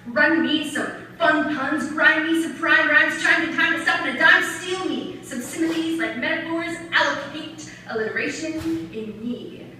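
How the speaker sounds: punchy rhythmic slam delivery; spoken-word poem. Acted. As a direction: hit every rhyme hard.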